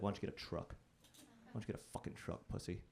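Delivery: low, quiet mock jeer; mocking truck guys. Guys ribbing him about his Subaru, low mocking murmur. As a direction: needle him under your voice, pitched low.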